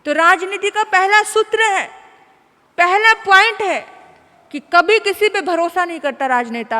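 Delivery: booming preacher's warning; forceful preaching. Acted. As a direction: drive the lesson home.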